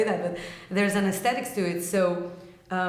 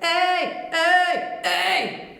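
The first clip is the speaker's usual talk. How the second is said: high, loud overdriven "hey"; heavy metal distortion; 10 semitones higher, 5 dB louder, much slower than usual. Shown as a demonstration. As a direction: traditional heavy metal shouts. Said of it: "more of a heavy sound, heavy metal sound".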